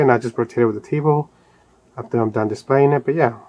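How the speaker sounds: normal talking voice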